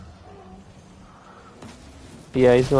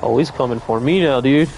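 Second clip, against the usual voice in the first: ordinary talk, nothing marked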